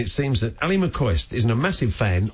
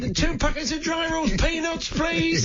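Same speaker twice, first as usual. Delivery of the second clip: much higher mimicry of the caller's speech pattern; imitating the caller's voice. Host mocking a caller's odd speech pattern, high mimicry. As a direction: parrot the caller's odd singsong, pitched way up. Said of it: "Interesting speech patterns".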